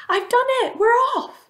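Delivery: very high, loud whoop of triumph; triumphant shout. A narrator's reading. Centipede through a hole in the ceiling, triumphant shout. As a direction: shout it high, thrilled with yourself. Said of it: "stuck his grinning face through a hole in the ceiling and shouted".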